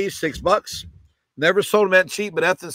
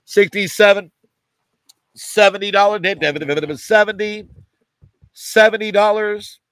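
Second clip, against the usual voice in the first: loud, rhythmic bid-calling chant; auction cadence; 3 semitones higher, 6 dB louder than usual. Real, not acted. Auctioneer calling bids to live buyers, loud rhythmic chant. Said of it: "in the middle of the cadence".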